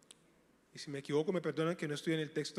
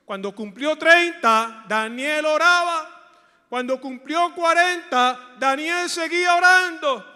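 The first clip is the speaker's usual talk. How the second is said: full-force preaching; 9 semitones higher, 15 dB louder than usual. Acted. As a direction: drive it home to the congregation. (predicación a todo pulmón)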